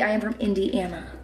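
ordinary talk

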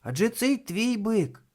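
put-on high boyish exclamation; calling out. A narrator's reading. Thomas telling Vroni it is hers, high call. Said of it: "гукнув Томас".

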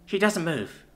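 ordinary delivery

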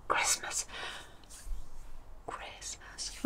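hushed, unvoiced whisper; playful secretive whisper. Real, not acted. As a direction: whisper it like a touchy subject. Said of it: "I'm whispering it".

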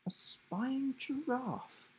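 high, slow, puzzled repeat; disbelieving echo. A narrator's reading. Will repeating the giraffe's words, slow and puzzled. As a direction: echo it back, not believing it.

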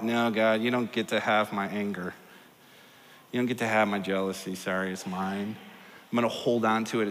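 low, quiet, possessive refusal; mock stubborn refusal. Congregation refusing to give God their anger and jealousy, stubborn. Acting out clinging to faults before God.

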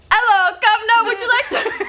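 mock British, high and loud, laughing; British accent. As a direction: play-act a posh Brit for a guessing game.

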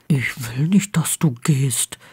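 low, hushed and mildly annoyed; annoyed whisper. A narrator's reading. Ron answers Harry's objection, annoyed whisper. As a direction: whisper it back with some irritation. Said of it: "flüsterte Ron zurück und klang verärgert".

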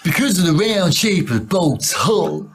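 high, bold put-on American accent. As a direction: show off a better American accent. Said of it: "I've got a better Amer-, American accent than you".

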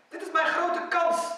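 high, loud, slow declaration; urgent insistence. Retold or imitated. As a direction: stretch it out loud and high, seizing the chance. Acted quote of Bilal seizing chance.

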